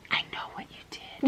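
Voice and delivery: mock-menacing whisper, no voice at all; whispered accusation. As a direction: whisper it like you've caught them out. Creepy whispered accusation in a retelling.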